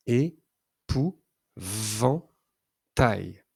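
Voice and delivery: é-pou-van-tail, very slow, syllable by syllable; teacher segmenting a word for a child; syllables drawn out. Shown as a demonstration. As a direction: stretch Épouvantail into its four syllables. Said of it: "entendons-nous le son V?".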